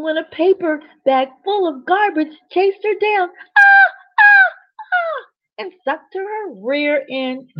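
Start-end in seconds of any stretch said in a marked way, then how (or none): 3.6-5.2 high shrill ah ah scream in panic. Acted. Narrator voicing Mabel's scream as garbage chases her. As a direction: shriek like a bag of trash is chasing you.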